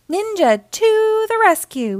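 high-pitched battle cry; heroic shout. A narrator's reading. Naughty Ninja grabbing the bubble bath, heroic shout. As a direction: cry it out like a little superhero. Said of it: "he shouted".

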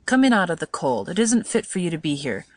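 mildly impatient son; a touch of exasperation with his mother. A narrator's reading. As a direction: chide her impatiently, understated. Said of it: "said Chester impatiently".

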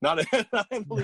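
giggling, high and quick; breaking into a laugh mid-correction. Real, not acted. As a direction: laugh through the words. Laughing while fumbling for name.

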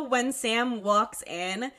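ordinary talk

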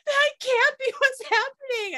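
very high squeaky imitation of wailing; mock bawling. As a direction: wail it in disbelief, hammed up. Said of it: "I'm bawling and I'm like".

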